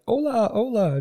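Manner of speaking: high, loud mock vendor hello; vendor greeting. A souk vendor greeting the tourists, high and loud. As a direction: call out to the passing tourists. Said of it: "one guy was like".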